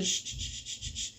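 series of soft, dwindling shushes; fading shushes. Retold or imitated. Student lowering his hand at the teacher, fading shushes. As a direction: hush repeatedly, each one fainter. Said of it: "le iba bajando la mano a medida que le iba haciendo".

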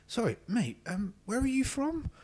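high-pitched, nosy questioner; inquisitive stranger. Retold or imitated. Stranger at work asking where he's from, inquisitive. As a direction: ask it high, with a squinty, puzzled curiosity. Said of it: "squinty sort of inquisitive look going on".

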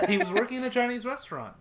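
giddy incredulous repeat; laughing repeat. Shown as a demonstration. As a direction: blurt it again, cracking up at it.

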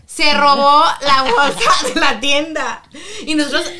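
laughing out loud, slowed down. Real, not acted. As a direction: laugh through the punchline. Laughing while telling the story. (contándolo entre risas fuertes)

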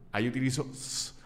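ordinary delivery

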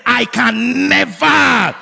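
slow, high, full-voiced shout; shouted declaration. Shown as a demonstration. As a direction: belt it out, stretching each word. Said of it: "Shout it one more time".

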